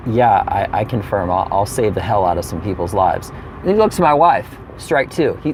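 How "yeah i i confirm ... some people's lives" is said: low, softened reply; low-voiced agreement. Passenger confirming to the flight attendant, low-voiced agreement. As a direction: answer way down in your range. Acting own answer to attendant.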